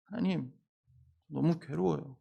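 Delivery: hushed, suffering plea to God; quiet acted lament. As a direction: groan it out softly as a prayer. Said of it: "울부짖는 탄식인 거죠".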